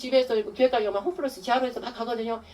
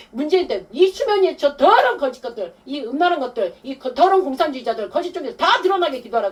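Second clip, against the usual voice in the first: fervent denunciation; 4 semitones higher, 6 dB louder than usual. Retold or imitated. Quoted command to pray against enemies.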